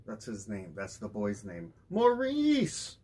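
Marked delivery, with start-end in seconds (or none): 1.9-3.0 high calling cry for his son. A narrator's reading. Father calling his son from the woodpile, high and loud. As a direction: call the boy's name across the yard. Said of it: "cried Daddy".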